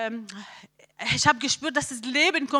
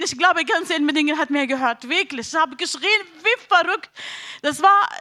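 ordinary delivery

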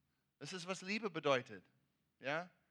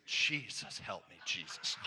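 a low, hammed-up cry for help from a tourist stuck on a collapsing bridge; mock panicked plea; 8 semitones lower than usual. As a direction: play the frightened woman clutching the rope, half joking. Blonde on the bridge cries out.

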